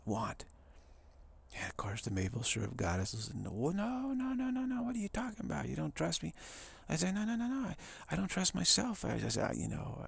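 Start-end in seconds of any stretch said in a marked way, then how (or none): 3.3-6.3 very high, stretched-out objection; mock indignant protest. Maple syrup goddess objecting to a second opinion, mock indignant. 7.1-9.0 high, rapid denial; mock earnest protest. Favor-doer reassuring the offended goddess, high mock protest.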